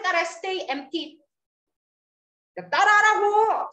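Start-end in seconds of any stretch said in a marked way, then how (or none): 2.6-3.7 raised, higher teacher's demand; louder and higher, prodding the class to repeat; insistent. Real, not acted.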